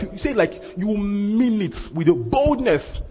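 high, drawn-out emphatic declaration; bold. Shown as a demonstration. Preacher showing the congregation how to say it, bold. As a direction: say it slowly with full conviction. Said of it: "he says with boldness".

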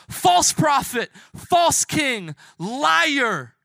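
high, loud drawn-out jeers; yelling. Acted. Us in the crowd yelling at Jesus, jeering. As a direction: yell each accusation at him. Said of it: "yelling at Jesus".

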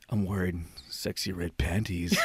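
low, slow mock-sultry voice; talking all sexy. Man messing back with the prankster, mock-sexy. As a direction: draw it out soft and low. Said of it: "he started talking all sexy".

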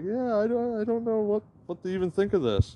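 high, baffled voice; bewildered husband answering his wife, high and baffled; baffled reply. Retold or imitated. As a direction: pitch it up in disbelief. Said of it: "And I'm like".

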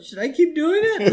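put-on high kid voice; put-on high-pitched child's question; imitated child. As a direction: ask like an unsure little kid. Kid asking Flanders, acted.

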